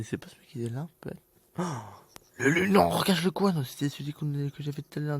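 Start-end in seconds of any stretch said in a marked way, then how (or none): 1.6-3.6 loud, high panicked order; raging gamer. Real, not acted. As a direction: yell at the character. Panicked calling to character.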